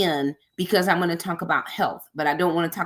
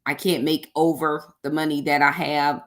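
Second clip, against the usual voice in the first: normal talking voice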